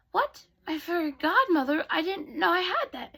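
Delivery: put-on high-pitched, astonished young heroine; acted surprise. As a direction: squeak out your disbelief. Cinderella is astonished by the news.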